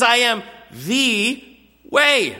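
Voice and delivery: "the" drawn out slow and high before "way"; stress on the. Shown as a demonstration. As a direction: lean hard on "the" in "I am the way". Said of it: "claiming exclusivity with the word "the".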